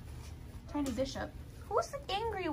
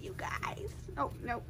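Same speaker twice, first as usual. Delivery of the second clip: ordinary talk, nothing marked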